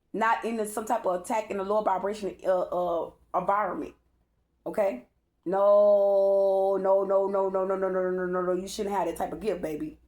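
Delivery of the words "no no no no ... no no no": louder, insistent string of no's; emphatic no. Real, not acted. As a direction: shut it down with firm no's. Emphatic denial to the audience.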